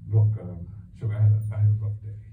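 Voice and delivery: calm him down, kindly asking for space; gentle husband. Retold or imitated. Husband tells his wife he needs time, gently.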